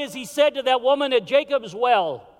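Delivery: ordinary talk, nothing marked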